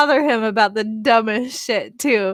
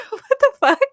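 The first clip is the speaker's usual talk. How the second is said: amused disbelief; 11 semitones higher, 4 dB louder than usual. Retold or imitated. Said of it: "And I was like".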